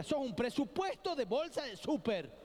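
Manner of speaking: high-pitched mock mom scolding; mother scolding. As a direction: play the exasperated mom. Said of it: "mi vieja me decía". (imitando a la madre que reta, voz aguda)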